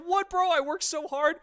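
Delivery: high, loud indignant whine; protest, stunned and hurt; shocked disbelief. Retold or imitated. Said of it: "I was like".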